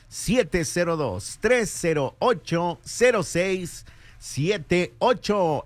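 slow, deliberate phone number; said slowly. Shown as a demonstration. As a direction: stretch each digit out clearly.

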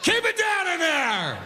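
high, slow shouted scold; annoyed wife. Retold or imitated. Wife yelling from next room at sneezing husband, high scold. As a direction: yell it from the next room, irritated. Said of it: "She's like".

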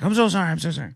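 put-on high apologetic Indian accent, drunk; imitated Indian accent. Drunk passenger apologising for smoking, mock Indian accent. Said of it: "Indian accent".

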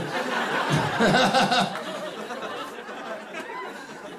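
high, dragged-out fake laugh; mock awkward laughter. Wet-handed greeter covering awkwardness, long fake laugh. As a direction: imitate a greeter laughing far too long.

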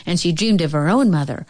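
plain reading voice

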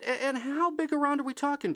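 nervous question. Retold or imitated. Host asking the neighbor how thick the snake is, nervous and high. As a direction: sound rattled but polite.

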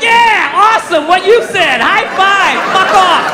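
high-pitched fake enthusiasm; fake agreement. Man agreeing so she'll leave him alone, mock enthusiasm. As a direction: cheer them along to get rid of them. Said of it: "You just agree with them".

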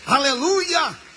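high, drawn-out hallelujah; ringing pulpit hallelujah; exultant praise shout. Real, not acted. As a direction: cry out praise to the congregation. Preacher shouts praise after climax.

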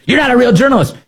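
very high mocking imitation; mimicking congresswoman. Host mimicking congresswoman dismissing reporter, high mock voice. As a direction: push the pitch right up, parroting. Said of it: "I don't even think you're".

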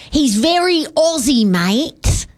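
a light put-on Aussie accent; lightly hammed Australian accent. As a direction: drop into a mild Aussie twang. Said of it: "you really gotta sound like this".

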